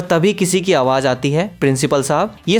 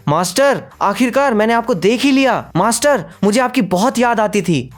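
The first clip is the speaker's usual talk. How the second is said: put-on high, eager fox girl voice; joyful reunion; 6 semitones higher than usual. A narrator's reading. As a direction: run to him crying out with joy. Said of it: "दौड़ते हुए बोलती है". (मालिक से मिलकर ऊँची, उत्साहित आवाज़)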